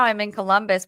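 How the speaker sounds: ordinary talk, nothing marked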